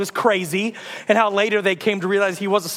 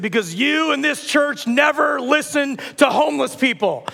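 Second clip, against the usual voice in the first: high, slow, declaimed accusation; raised prophet voice, drawing out the charge; accusing prophet; 5 semitones higher than usual. Acted. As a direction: denounce them like a street prophet.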